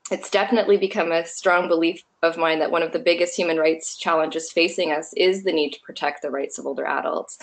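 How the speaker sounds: ordinary delivery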